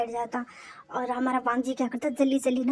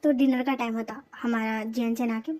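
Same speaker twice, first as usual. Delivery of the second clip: ordinary delivery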